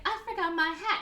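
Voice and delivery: high-pitched film heroine voice; bad Ingrid Bergman. Woman makes an excuse to the man, bad Ingrid Bergman. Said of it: "with my bad Ingrid Bergman".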